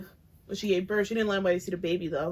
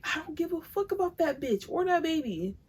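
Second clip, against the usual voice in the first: higher, dismissive mocking voice; mock Chrisean; 7 semitones higher than usual. Voicing the mother brushing him off, higher and dismissive. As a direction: toss it off like she couldn't care less.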